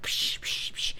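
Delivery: voiceless "psh" crash noises; quick hushed smashing effects; imitated smashing plates. Narrator showing plates hitting the ground, imitated smashing. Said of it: "tossing them on the ground".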